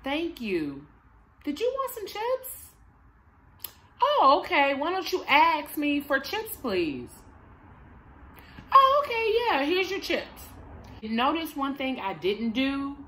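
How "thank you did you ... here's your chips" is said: put-on high, bright praise for a calm child; praising the child. Shown as a demonstration. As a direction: reward them with cheerful praise. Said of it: "catch them being good".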